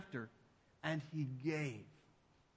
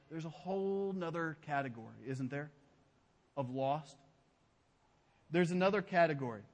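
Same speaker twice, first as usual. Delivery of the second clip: ordinary delivery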